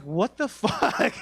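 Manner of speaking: loud, high, drawn-out outrage; outraged disbelief. Retold or imitated. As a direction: retell your indignant reaction, slow and loud. Outraged reaction to cashier's greeting.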